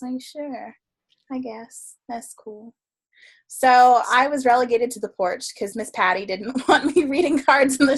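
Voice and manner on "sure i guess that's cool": much softer, offhand acceptance; mumble-quiet, noncommittal retold reply. Acts own muted reply to invitation.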